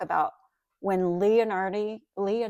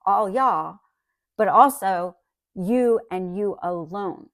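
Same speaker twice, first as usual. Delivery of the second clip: "all y'all" and "you alone" pushed louder and slower; emphatic y'all; 5 dB louder, slower than usual. Shown as a demonstration. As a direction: lean on every "you". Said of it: "he used an emphatic plural".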